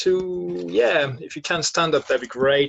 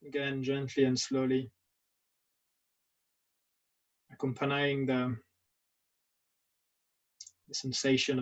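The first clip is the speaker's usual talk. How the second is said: guided meditation; 4 dB quieter than usual. Acted. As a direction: guide them gently through a standing body scan, leaving long silences. Leads a standing meditation.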